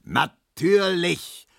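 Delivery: NATÜRLICH drawn out slowly, high; slow, furious exclamation; angry roar. A narrator's reading. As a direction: stretch it into a sarcastic bellow. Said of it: "brüllte Herr Markowski".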